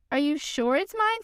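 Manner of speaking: ordinary delivery, nothing marked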